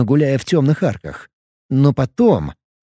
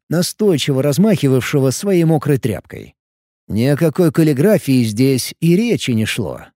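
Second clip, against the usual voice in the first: plain reading voice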